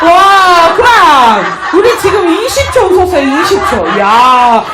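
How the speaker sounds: big, excited coach's cheer; amazed praise. Real, not acted. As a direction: marvel loudly at the group's feat. Amazed after group laughing exercise.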